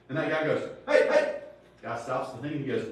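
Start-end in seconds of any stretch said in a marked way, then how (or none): 0.9-1.4 high, loud "hey" to stop someone; sudden loud call. Retold or imitated. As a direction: shout across the street to stop him. Man calls out to stop cart.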